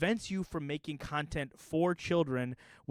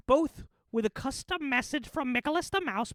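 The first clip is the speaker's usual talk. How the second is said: a hint of the cartoon mouse; 10 semitones higher, 4 dB louder than usual. Promising fans a Cameo message as the mouse.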